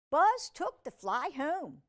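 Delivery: ordinary delivery, nothing marked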